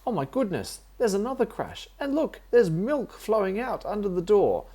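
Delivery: panicking at the mess; rising alarm, high and loud; alarmed aunt. Acted. Aunt alarmed at spilled milk.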